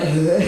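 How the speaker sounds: acted cheese burp; mock belch mid-meal. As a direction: let out a fake burp. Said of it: "And then I'm burping".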